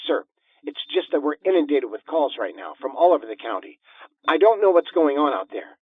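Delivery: a hint of an overwhelmed dispatcher; lightly voiced harried operator. A narrator's reading. Operator telling the caller about the flood of calls, lightly harried. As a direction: explain like an operator swamped with calls.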